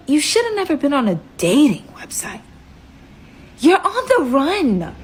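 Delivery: a sharp retort that slows down on the last words; cutting him off. Retold or imitated. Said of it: "I cut him off".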